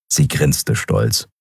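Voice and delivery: ordinary delivery, nothing marked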